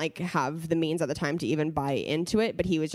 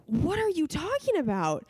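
a higher, slowed-down note of disbelief; stunned husband; 11 semitones higher than usual. Retold or imitated. Husband hearing she paid five hundred dollars, incredulous. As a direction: play the husband, shocked and incredulous.

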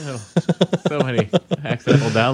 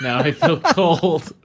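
mocking laughing; 5 semitones higher, much slower than usual. Mock repeat of line.

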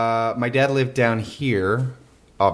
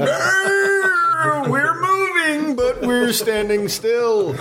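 high, slow, laughing movie-hero mockery; hammed-up movie hero; much slower than usual. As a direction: shout it like a cheesy eighties hero. Mocking the cheesy movie effect.

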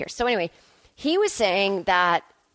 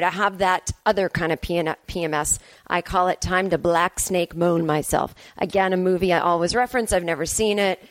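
ordinary delivery

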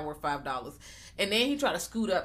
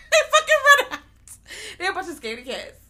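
helpless high, loud laugh through words; 9 dB louder, faster than usual. Real, not acted. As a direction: crack up while telling it. Cracks up retelling their flight.

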